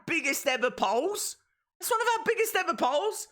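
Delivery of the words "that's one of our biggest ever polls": much higher and faster, thrilled; excited repeat. Shown as a demonstration. Streamer marvelling at the poll count to chat, thrilled and fast. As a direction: say it again, overjoyed.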